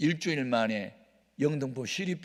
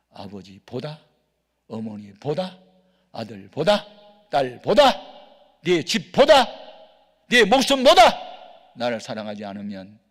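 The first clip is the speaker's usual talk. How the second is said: God's voice; 3 semitones lower, 10 dB louder, slower than usual. Retold or imitated. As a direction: start hushed and swell to a thunderous demand. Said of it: "이 음성을 내가 들어요".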